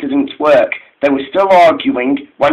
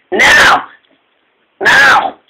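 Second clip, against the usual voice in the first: sharply higher shouted signal; 10 semitones higher, 4 dB louder than usual. A narrator's reading. Douglas giving his twin the signal, shouted. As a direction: shout the cue, pitch leaping up. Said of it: "shouted Douglas".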